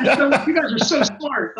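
high-pitched mock gushing through laughter; hammed-up flattery; gushing praise. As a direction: gush like a smitten admirer, half laughing. Gushing stakeholder act continues, laughing.

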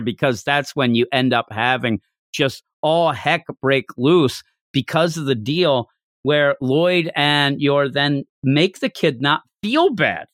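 ordinary delivery

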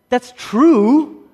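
high, loud mock pedant concedes; mock know-it-all. Preacher mocks a music analyst agreeing, high and loud. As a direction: play a fussy theorist conceding the point.

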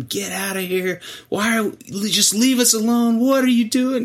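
imitating a woman laying into him; mock scream. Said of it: "just screaming at him".

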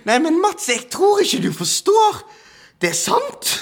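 in shock. Retold or imitated. Stunned psychologist friend insisting to Mats, shocked and urgent. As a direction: press it as if the world just flipped. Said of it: "han er jo i sjokktilstand nå".

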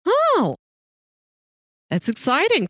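normal talking voice

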